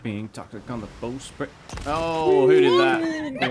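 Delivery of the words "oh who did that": high startled yell, slowed; startled shout. Real, not acted. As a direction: yell in shock at the hit. Sudden damage in game.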